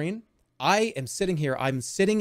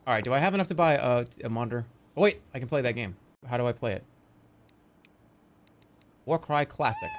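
normal talking voice

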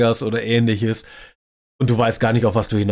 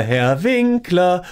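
higher, drawn-out mock call; imitated calling out; 6 semitones higher, much slower than usual. Someone on the street hailing Herr Winkler, mock calling out. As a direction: call out the name, stretched and pitched up. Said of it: "ruft vielleicht mal".

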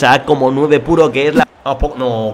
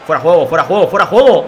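rising shout, louder on the last call; shouting offside; 5 dB louder than usual. Real, not acted. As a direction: yell it at the screen as the play goes on. Reclama fuera de juego gritando. (gritando fuera de juego cada vez más fuerte)

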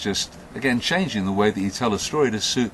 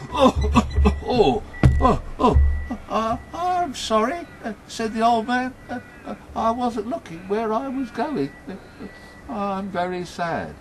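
high, stammering apology after a bump; flustered old man; 13 semitones higher, 3 dB louder than usual. Acted. Bumped man apologises in story.

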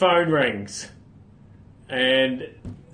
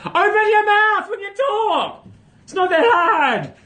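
exasperated yell; 5 dB louder, slower than usual. Acted. Yelling at rugby coaches who mumble.

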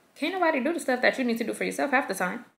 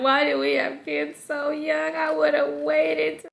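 higher, slow mock whine; complaining; 6 semitones higher, slower than usual. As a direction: moan it to your husband. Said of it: "I'm complaining to my husband".

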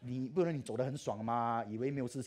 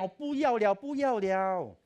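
crying protest; 10 semitones higher, 12 dB louder, slower than usual. Retold or imitated. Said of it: "我讲".

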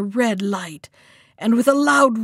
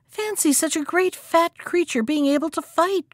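a high, lively cry of mock wonder; the hare cries out in pretend amazement; cried out; 7 semitones higher than usual. A narrator's reading. Hare to the wounded lion, a high mock-amazed cry. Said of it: "cried the hare".

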